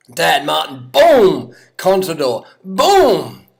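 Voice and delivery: riders' names, then high, loud drawn-out booms; explosive commentary. Pretend. Commentator watching riders crack one by one, explosive shouts. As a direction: fire off each boom like a blast.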